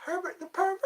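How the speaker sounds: very high cartoon impression; Herbert the Pervert. Naming a favourite cartoon, high character impression. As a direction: say the name in the character's falsetto. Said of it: "those cartoons of".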